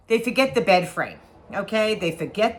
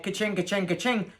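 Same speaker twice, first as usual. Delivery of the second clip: soft, muttered cash-register chime; cash register ka-ching; 4 dB quieter than usual. Retold or imitated. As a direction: quietly ring up the money in your head. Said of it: "I'm going in my head".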